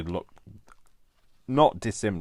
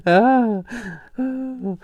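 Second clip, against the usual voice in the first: laughing; 12 dB louder than usual. Real, not acted. Apologising mid laughing fit.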